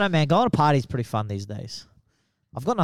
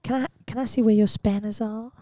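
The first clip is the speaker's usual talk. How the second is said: [name] voice; 7 semitones higher than usual. Acted. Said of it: "[name] Goes to Bunnings".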